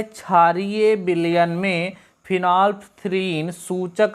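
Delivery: each word drawn out for dictation; slow reading. Shown as a demonstration. Teacher reading a quiz question to students, slowly. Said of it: "लिखा है".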